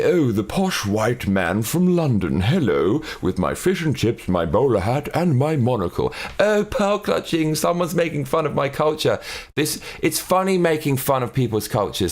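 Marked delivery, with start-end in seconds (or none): 0.0-6.1 posh Londoner. Acted. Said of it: "Someone can do a parody of me". 6.4-9.2 mock outrage. Mocks someone offended at parody.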